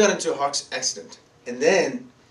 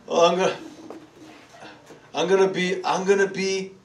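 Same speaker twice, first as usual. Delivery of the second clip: mock tearful lament, higher pitch; imitated crying; 5 semitones higher, 4 dB louder than usual. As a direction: re-enact a faltering, self-pitying wail. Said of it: "I was crying like".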